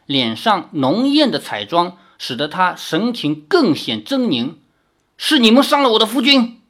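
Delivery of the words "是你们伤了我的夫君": high, loud and fast, accusing; angry accusation. A narrator's reading. Fox demon's wife accuses the heroes, angrily. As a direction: hurl the blame at them.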